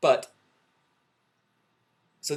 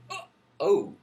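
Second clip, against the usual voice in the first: high, loud put-on "oh"; hammed-up moaning reaction; mock gasping moan; 8 semitones higher, 5 dB louder than usual. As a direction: imitate an over-the-top pill reaction. Mimics people swallowing the pill.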